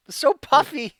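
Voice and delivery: high loud cooing. Real, not acted. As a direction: gush over a kitten. Cooing over tiny cat.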